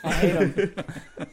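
slow, laughing through the words. Real, not acted. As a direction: drag it out while laughing. Ranting about Yak Baks.

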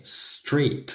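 street with no vowel before the s; English street. Shown as a demonstration. Teacher modelling street with no e in front. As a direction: say street starting straight on the s. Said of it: "No decimos street".

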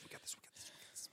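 fading to a tiny whisper; whispered pep talk. Pretend. Host reassuring cohost about remaining time, whispered. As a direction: whisper it like a mantra.

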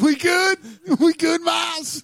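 high, drawn-out put-on voice; movie-character parody. Imitating Pulp Fiction banter with a co-host, high put-on voice.

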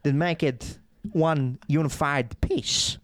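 slow, halting impersonation with odd breaks; Christopher Walken. Shown as a demonstration. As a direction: break phrases into separate chunks, pausing mid-sentence. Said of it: "Christopher Walken has a lot of isms".

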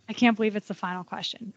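normal talking voice

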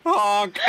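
high, loud goose honk through a laugh. Pretend. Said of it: "I had to say it back".

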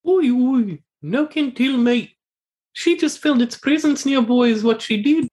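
high squeaky voice of the odd companion; Talks to herself voice. Acted.

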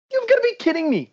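can't believe what he's hearing; incredulous. Real, not acted. Fan ranting about his team's owner, incredulous and high-pitched. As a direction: pitch jumps way up in disbelief.

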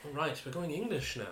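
put-on playful English accent. Host teasing co-host about English names, put-on English accent. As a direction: switch into a mock English accent. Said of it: "I'm using my English accent now".

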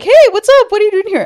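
high, loud, bubbly hello; mock friendly greeting. Imitating girlfriends greeting the boy in the driveway, mock friendly.

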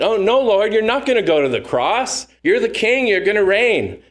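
high, pleading protest; Peter protesting. Acted. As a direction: plead with the Lord not to go.

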